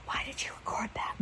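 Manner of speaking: hushed, embarrassed whisper to yourself. Pretend. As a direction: whisper it like a cringing future self. Imagined future self, embarrassed.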